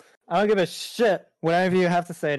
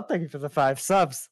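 ordinary delivery

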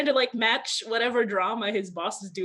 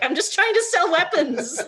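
higher, louder exasperated protest; exasperated mock complaint, voice raised; 8 semitones higher, 6 dB louder than usual. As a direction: plead it like the one sane guy. Acting out the exasperated Sagat.

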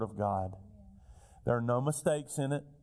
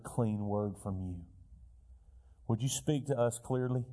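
earnest pastoral prayer; 3 semitones lower than usual. Acted. Pastor leads congregation in prayer.

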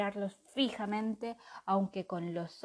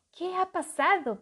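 puzzled; 9 semitones higher, 5 dB louder than usual. A narrator's reading. Lila puzzled.

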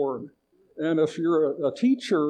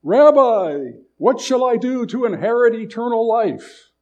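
raised voice calling out to the teacher; spoke loudly; 5 semitones higher, 8 dB louder than usual. A narrator's reading. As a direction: call it out for all to hear. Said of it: "the man spoke loudly enough for all to hear".

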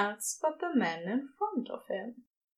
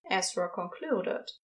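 ordinary delivery, nothing marked

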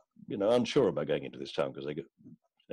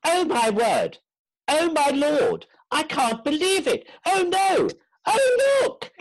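piercingly high excited shrieks; very loud Englishwoman; 8 dB louder, slower than usual. Acted. Englishwoman spots two stars across the square, very loud shrieking. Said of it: "this very loud Englishwoman went".

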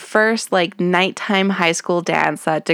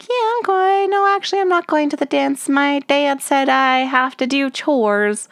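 put-on high squeaky teen girl voice, much higher; Terry impression; 8 semitones higher than usual. Imitating Terry making excuses to Spinner, high and squeaky. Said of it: "And Terry's like".

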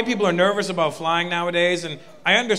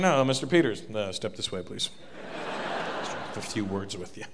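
low, soft, ominous official; hushed, low, menacing officer; customs officer; 10 semitones lower, 9 dB quieter than usual. Retold or imitated. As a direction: quietly pull him aside like a stern officer. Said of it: "They get my passport".

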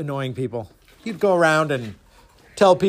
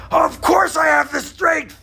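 high, slow, strained shout; outraged samurai; 12 semitones higher, 4 dB louder than usual. Acted. Samurai drawing his sword at the master, outraged. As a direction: shout it high, dragging each word.

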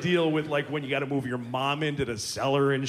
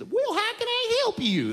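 higher Southern lady voice; Texan lady; 3 dB louder than usual. Acted. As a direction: play a friendly Texas operator. Texas phone operator.

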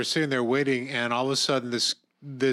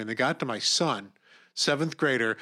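usual speaking voice

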